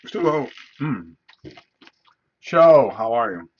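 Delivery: talking around ice cubes; ice in mouth. Real, not acted. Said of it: "Sorry, I have ice in my, my mouth".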